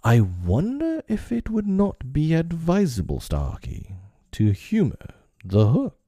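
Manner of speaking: high, mock-wondering menace; silky threat. A narrator's reading. Pirate captain advising a crewman to obey, silky menace. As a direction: lift the pitch in mock politeness, dropping on the name.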